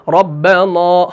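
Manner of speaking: recited ربنا with a rounded, heavy final aa; dark long vowel ending ربنا; wrongly thick alif. Shown as a demonstration. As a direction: fill the mouth on the نا of ربنا. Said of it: "وہاں پر نہیں کر رہے ہیں".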